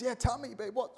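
a keen fiancé answering his babe; eager reply. Acted. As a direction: lean in happily to hear the news. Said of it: "Joseph looks and says".

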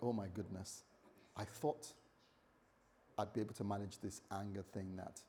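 hushed, low, deflated self-reproach; acted regret. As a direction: drop soft and low, as if let down by yourself. Acting out regret after exploding.

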